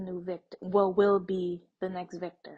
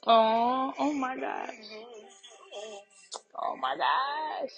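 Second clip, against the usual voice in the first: moved; 3 semitones higher, 3 dB louder, slower than usual. Real, not acted. Reacting to a tearjerker scene, moved. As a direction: overcome by the scene. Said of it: "a little tearjerker".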